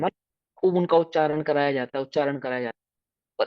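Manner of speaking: ordinary talk, nothing marked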